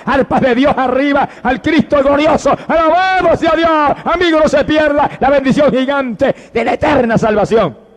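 high-pitched, driving revival sermon; exultant, urgent pulpit cry; impassioned preaching. Real, not acted. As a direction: preach it at full force, phrase upon phrase. Preaches at full force.

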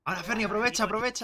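high, loud hype; excited urging. Real, not acted. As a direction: cheer a teammate on. Urging teammate in a clutch.

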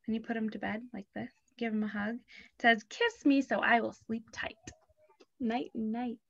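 soft bedtime voice; hushed. Acted. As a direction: hush it like a lullaby. Putting finger to bed.

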